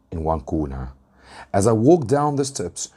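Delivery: ordinary delivery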